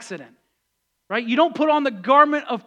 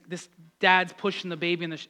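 normal talking voice

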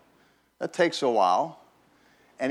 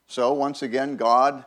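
usual speaking voice

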